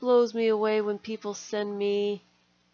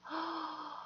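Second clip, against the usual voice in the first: gasp; 8 semitones higher, 11 dB quieter than usual. Real, not acted. Sees signatures.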